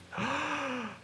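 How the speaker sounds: sharp high astonished intake of breath; amazed gasp. Acted. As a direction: gasp in wonder. Girl rises from the dead.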